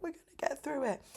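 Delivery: high, quick comic imitation of a guy gassing up his friend; funny hype-up. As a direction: imitate the funny pep talk, pitched high. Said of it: "saying it in a really funny way, like gassing".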